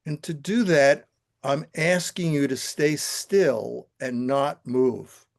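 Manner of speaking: calm, steady guiding voice, unhurried; guided meditation. Acted. Teacher guiding the class through the visualization, calm meditation voice. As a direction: lead the room gently into stillness.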